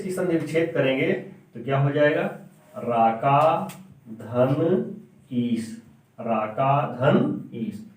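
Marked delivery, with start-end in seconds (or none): 2.8-7.9 very slow, each part drawn out; slow dictation. Shown as a demonstration. Teacher dictating the word split for pupils, very slow. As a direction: spell it out for the class.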